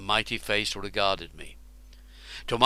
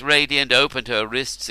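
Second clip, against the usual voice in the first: usual speaking voice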